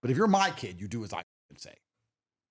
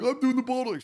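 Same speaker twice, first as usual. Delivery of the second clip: mocking new dad; 11 semitones higher than usual. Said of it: "they've gone like".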